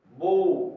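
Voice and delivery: "बो" loud, with a long o; model pronunciation. Shown as a demonstration. Teacher shows that o before w says o, model pronunciation. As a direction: hold the o in "बो" firmly. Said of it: "इसको बोलेंगे बो".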